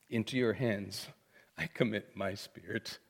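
low, soft, paused prayer; hushed surrender. Retold or imitated. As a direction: entrust it quietly, pausing midway. Jesus' dying words, repeated softly.

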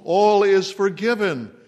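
All is forgiven, ringing out high and loud; loud proclamation. Acted. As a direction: cry out "All is forgiven" as God's welcome home. God's call, proclaimed.

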